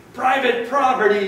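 high, loud storybook yell. A narrator's reading. Grumpy cactus warning off the tortoise, yelling. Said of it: "yelled Hank".

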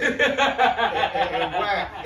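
laughing, can barely get words out; stuttering through laughter. Real, not acted. Laughing while trying to talk.

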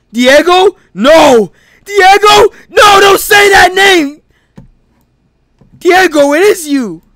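high, loud crying shout; soap opera reveal. Acted. Tyrell recognizing his old friend, hammed-up crying shout. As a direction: wail the name in melodrama.

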